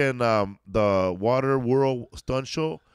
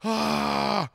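imitated child's scream; 8 semitones higher than usual. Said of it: "screaming like".

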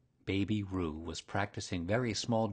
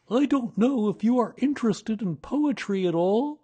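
higher, louder hesitant bear; Pooh voice; 13 semitones higher, 8 dB louder than usual. A narrator's reading. Pooh tries to distract Kanga with poetry, higher. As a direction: awkwardly bring up poetry.

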